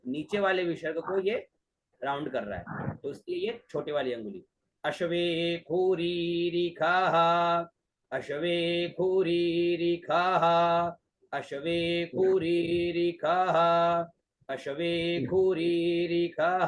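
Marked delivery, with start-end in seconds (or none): 4.8-16.7 अश्वे खूरी रिखाः with the accent pitch held level; Vedic pitch accent. Shown as a demonstration. Teacher showing the accent on अश्वे खूरी रिखाः. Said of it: "खोरी रेखा बीच में ही रहेगा".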